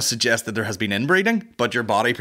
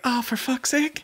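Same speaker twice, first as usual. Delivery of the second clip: exasperated; slower than usual. Real, not acted. Exasperated realising live audience.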